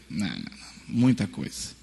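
brushing off a mountain of search results; low muttered dismissal. Retold or imitated. Student muttering at too many pages, low and soft.

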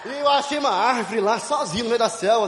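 ordinary delivery

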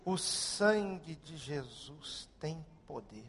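retold quiet cry from the soul; unrehearsed hushed prayer. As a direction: let it slip out slowly under the breath. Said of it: "foi o que saiu na hora".

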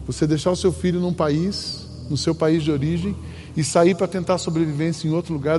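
ordinary talk, nothing marked

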